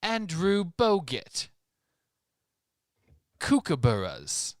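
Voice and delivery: put-on slow, drawn-out Australian accent. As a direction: stretch it like a cartoon Aussie. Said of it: "the Australian accent".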